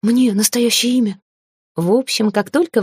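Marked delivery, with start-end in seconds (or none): 0.0-1.2 higher, wondering character voice; astonished house spirit. A narrator's reading. House spirit cannot believe he gets a name, high wonder. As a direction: ask it in high amazement. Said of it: "Домовой вытаращился".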